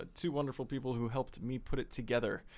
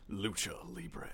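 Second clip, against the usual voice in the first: intense imitation; 5 dB quieter, slower than usual. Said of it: "the very intense".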